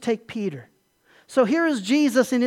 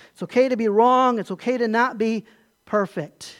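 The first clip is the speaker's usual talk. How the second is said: normal talking voice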